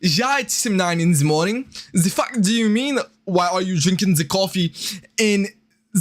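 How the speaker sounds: cartoonish German accent, Z for TH; mock German accent. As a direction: put on an indignant German. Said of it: "to show you that I'm German?".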